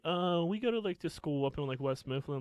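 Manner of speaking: high-pitched mock girl voice; imitated evasive girls. Girls dodging a question about their school, high imitated voice.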